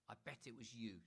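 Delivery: usual speaking voice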